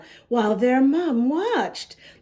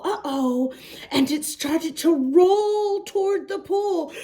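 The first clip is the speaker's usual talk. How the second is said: ordinary delivery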